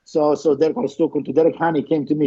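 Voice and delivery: ordinary delivery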